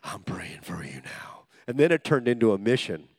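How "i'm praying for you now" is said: slow, low whispered prayer. Retold or imitated. Preacher whispers a prayer at the mocker. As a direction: whisper it under your breath.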